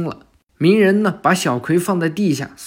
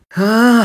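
startled cry; 6 semitones higher, 8 dB louder than usual. Acted. Naruto startled by the laser.

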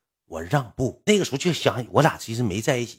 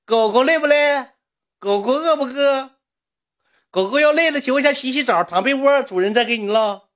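mock doting master; 5 dB louder than usual. Supporter pampering the streamer as a dog, falsetto mock baby talk. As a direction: coo to your doggy in a squeaky falsetto. 演主人哄狗狗.

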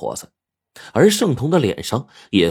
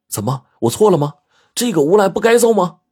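indignant, high and loud comeback; huffy retort; 12 semitones higher, 5 dB louder than usual. A narrator's reading. Shengtong defending the punch to Xiaoqin, huffy. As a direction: fire back rhetorical questions, rising in pitch. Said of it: "就气呼呼的反问".

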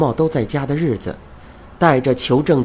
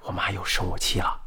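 soft, low secret aside in the ear; whispered aside; 8 semitones lower, 9 dB quieter than usual. Acted. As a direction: lean in and confide it quietly, low and soft. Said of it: "趴在我耳边小声说道".

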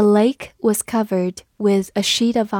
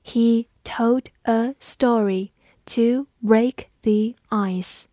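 slow, careful model reading; teacher reading slowly for the class; slower than usual. Shown as a demonstration. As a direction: stretch each word out slowly. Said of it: "我们慢读一遍".

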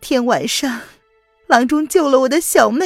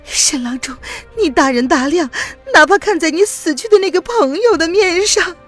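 high, loud, choked pleading; humble yourself through tears; desperate tearful plea; 11 semitones higher, 3 dB louder than usual. Acted. Woman humbly begging old rival physician, high and tearful.

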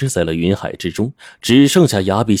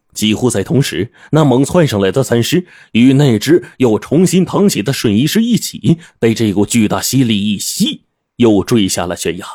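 ordinary delivery, nothing marked

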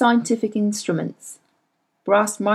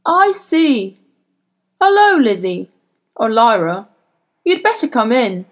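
put-on a suave older gentleman, raised and feigning surprise; smooth gentleman; 4 dB louder than usual. A narrator's reading. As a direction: play the polished host who pretends not to know her. Sir Charles voiced by narrator.

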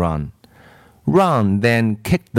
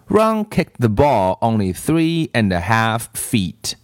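usual speaking voice

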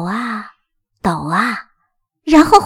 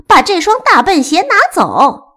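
high, loud little-sister yell; high-pitched indignant cry; shouted complaint; 7 semitones higher, 6 dB louder than usual. Acted. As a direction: shout the order in annoyance. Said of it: "小爱叫道".

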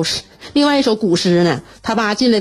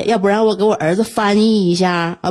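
ordinary delivery

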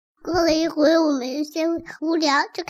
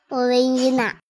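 ordinary delivery, nothing marked

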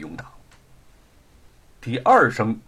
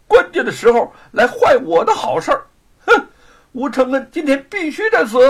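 high, loud furious snarl; teeth-gritted fury; 10 semitones higher, 7 dB louder than usual. A narrator's reading. Villain Luo Pang vents his rage at the interruption, furious. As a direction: spit it out through clenched teeth. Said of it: "罗庞狠狠地咬了牙".

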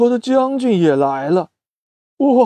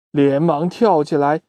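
plain reading voice

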